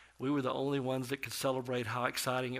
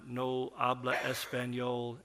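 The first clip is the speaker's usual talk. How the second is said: slow, halting broken Spanish from an English speaker; slower than usual. Retold or imitated. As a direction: sound it out in a gringo accent, slowly. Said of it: "in a very, uh, broken".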